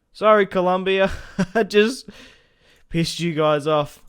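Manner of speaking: loud, high, laughing sorry; laughing apology. Real, not acted. Gamer apologizing to Colombia, loud and laughing. Apologizes to nation after misclick.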